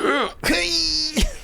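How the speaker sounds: high shocked gasp; sharp gasp. Real, not acted. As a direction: gasp in sympathy. Reacting to rigging story.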